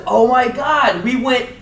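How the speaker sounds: high, loud, breathless excitement; hammed-up excited college kid; excited fan. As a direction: gush about the trip like a proud kid. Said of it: "And he says".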